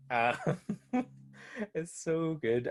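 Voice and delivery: laughing. Real, not acted. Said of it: "laughing while speaking".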